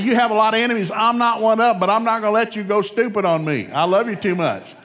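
loud, high, impassioned parent's declaration; full-force preaching. Acted. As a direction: belt it out like a preacher.

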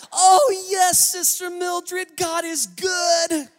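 high, loud, slow, gushing church-lady greeting; overly pious phone voice. Retold or imitated. Mom switches to holy sweetness for Sister Mildred, loud and slow. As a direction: ham up a saintly mom on the phone. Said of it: "trying to say the right thing".

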